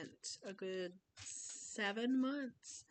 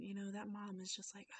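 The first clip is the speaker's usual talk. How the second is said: ordinary talk, nothing marked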